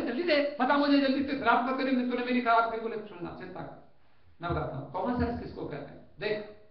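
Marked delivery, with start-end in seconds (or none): 0.0-2.8 louder, higher pushy complaint; impatient demand. Acted. Guest demands his common sense at once, loud and impatient. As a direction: hurry him up, fed up.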